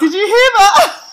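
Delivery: high, loud laughing question; laughing in loud disbelief; laughing loudly. Real, not acted. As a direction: burst out laughing at the phone. Siri activates, she laughs.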